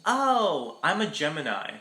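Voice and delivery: high, airy mock ditzy girl. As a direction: play a clueless girl mishearing. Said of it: "And she's like".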